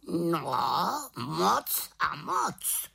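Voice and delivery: slow, high raven call; zvolání havrana. A narrator's reading. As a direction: draw it out as an exclaiming raven. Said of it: "zvolal Havran". (protáhlé havraní zvolání)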